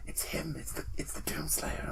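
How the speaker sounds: imitating awestruck whispers; hushed awe. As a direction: whisper it like a frightened onlooker. Scientists whispering in fear of player.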